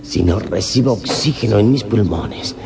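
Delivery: fighting for air in panic; panicked and breathless. Acted.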